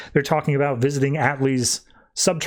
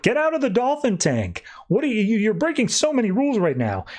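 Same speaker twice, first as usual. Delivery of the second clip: high, yelled order to get out; mock yell; 8 semitones higher than usual. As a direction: bark it like a furious trainer. Staff yelling from tank side.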